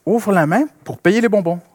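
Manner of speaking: father insisting. Retold or imitated. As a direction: tell the child it's time to pay. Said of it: "Je dis".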